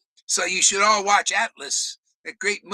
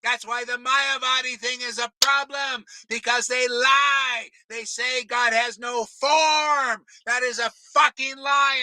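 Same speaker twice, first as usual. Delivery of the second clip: yelling; 6 semitones higher than usual. Real, not acted. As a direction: hammer it home, shouting. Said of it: "Do I have to yell more?".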